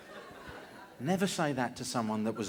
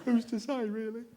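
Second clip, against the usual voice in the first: very high little voice; small, high-pitched mock-musing voice; put-on character voice; 9 semitones higher than usual. Spider in a beret chatting about literature, tiny high voice.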